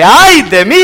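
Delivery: loud, high cry of woe; sudden loud lament, pitch jumping up; anguished cry. Acted. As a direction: cry it out in dismay. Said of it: "Isaías dijo".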